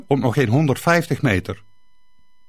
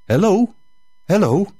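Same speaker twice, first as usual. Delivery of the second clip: priest calling again, more insistent; 5 semitones higher than usual. Acted. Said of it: "riep hij opnieuw".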